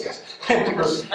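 ordinary talk, nothing marked

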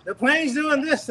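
the plane bucking in the storm, alarmed; alarmed retelling. Retold or imitated. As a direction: relive the lurch of the plane with alarm. Panicked retelling of turbulence.

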